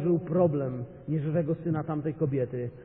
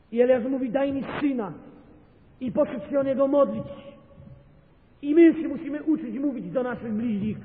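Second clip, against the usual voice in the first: much higher and louder, ringing out; fervent preacher at the climax; preaching at full force; 8 semitones higher, 5 dB louder than usual. Acted. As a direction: hammer it from the pulpit. Peak of the sermon.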